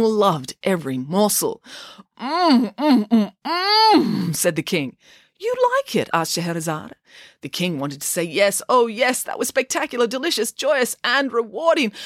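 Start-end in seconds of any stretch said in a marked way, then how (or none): 2.2-4.3 high, rapturous "mmm" noises; savouring moans. A narrator's reading. The king tastes the divine cake, savouring it. 5.4-6.1 very high, innocent query; coy question. A narrator's reading. Scheherazade asks the delighted king, coy. 8.2-11.9 high, gushing list of praise; rapturous. A narrator's reading. The king longs to praise the cake, rapturous. King's unspoken rave about cake.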